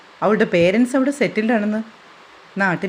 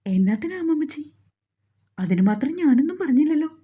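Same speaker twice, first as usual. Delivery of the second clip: high pleading; 6 semitones higher than usual. Acted. Grandson soothes grandmother.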